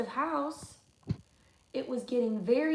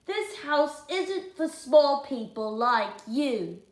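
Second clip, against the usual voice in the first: slow, drawn-out, louder rule; slow, snooty decree; 3 semitones higher, 6 dB louder, slower than usual. Acted. George telling Freddie to stay out, slow. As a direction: lay down the rule deliberately.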